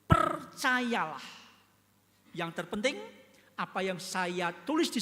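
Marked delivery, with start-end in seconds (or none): 0.0-1.5 very slow, louder call to believe; John urging readers to believe; solemn exhortation. Acted. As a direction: draw out the plea to believe. John's exhortation voiced.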